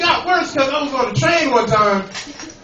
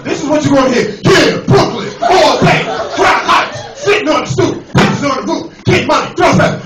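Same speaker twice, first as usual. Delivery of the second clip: rowdy hype announcer; 3 semitones higher, 4 dB louder than usual. Acted. Q train announcer warns riders about Brooklyn, rowdy and loud. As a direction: fire off chopped bursts like a hype man.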